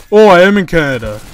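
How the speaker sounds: a caller mocking the support agent's claim, loudly; mocking loud quote. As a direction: throw his words back at him, mockingly.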